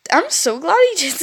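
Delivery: ordinary talk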